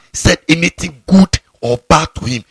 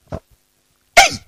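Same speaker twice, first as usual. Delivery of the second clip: sudden very high squeal; sharp high yelp. Acted. Pastor jolting the congregation with a squealing yelp. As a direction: yelp to jolt the listeners. Pastor yelps mid-sermon.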